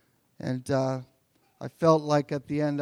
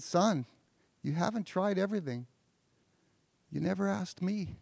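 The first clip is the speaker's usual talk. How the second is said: softer, tender fatherly voice; gentle father; 4 semitones higher, 5 dB quieter than usual. Retold or imitated. Father gently telling his crying son the answer, soft and tender. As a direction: comfort the crying boy quietly.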